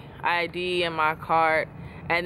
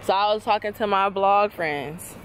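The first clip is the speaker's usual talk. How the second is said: playful teasing; 6 semitones higher than usual. Real, not acted. Teasing boyfriend on arrival.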